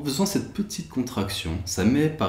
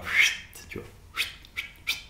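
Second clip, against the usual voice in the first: shushing. Acted. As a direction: hush them gently. Shushing during meditation demo.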